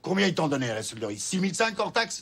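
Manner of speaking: angry demand. Acted. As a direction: confront the salesman over the price. Boss confronts the salesman.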